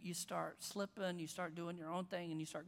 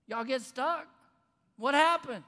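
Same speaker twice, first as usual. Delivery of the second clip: loud, higher jeering call; taunting from the road, loud and high; mocking passerby; 5 semitones higher, 12 dB louder than usual. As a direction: shout the taunt up at the half-built frame. Passersby mocking unfinished building.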